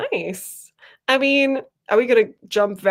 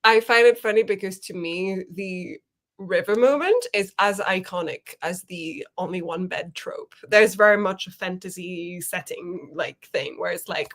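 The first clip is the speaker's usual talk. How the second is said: ordinary delivery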